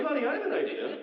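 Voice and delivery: high, bright mock suggestion to wife; mock family dad. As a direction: pitch a terrible vacation to his wife. Iowa family planning vacation.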